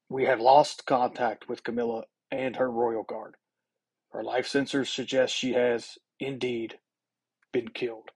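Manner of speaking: lightly voiced king, measured and grave with pauses; king addressing crowd. A narrator's reading. King telling senators of Camilla's death, measured address. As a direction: announce a death formally, pausing before the end. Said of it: "The king speaks over the noise".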